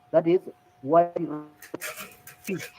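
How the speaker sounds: ordinary delivery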